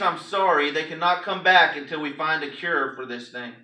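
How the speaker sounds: ordinary delivery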